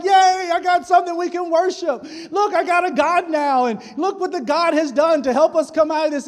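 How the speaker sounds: high, loud cheer of the people; excited crowd. Retold or imitated. Israelites cheering over their golden calf, loud and excited. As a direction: cheer like a crowd that found a new idol.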